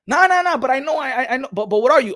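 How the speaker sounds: high, stammering, pressing interruption; insistent questioner. Acted. Same questioner insists, cutting in.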